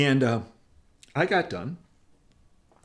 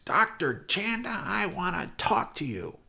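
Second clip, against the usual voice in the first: slow, higher, a mock booming summons; booming chairman; 8 semitones higher, slower than usual. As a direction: call him over with authority, slowly. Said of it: "this booming voice".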